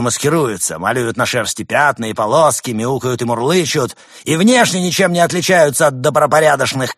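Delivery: high, loud conspiratorial rant; ranting demagogue. Acted. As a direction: preach paranoia to the crowd. Demagogue speech.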